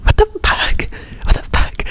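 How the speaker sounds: mock-incredulous, hushed and breathy exclamation; outraged onlookers. Imitating people reacting to her Escalade, scandalised whisper. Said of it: "people were like".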